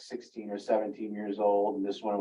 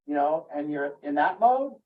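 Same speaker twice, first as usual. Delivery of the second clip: normal talking voice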